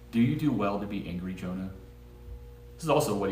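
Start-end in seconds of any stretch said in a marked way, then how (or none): 0.1-1.7 softer, lower gentle question; God softly asking Jonah about his anger. Retold or imitated. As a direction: ask it gently, like a tender father. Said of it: "I imagine that being a very soft voice".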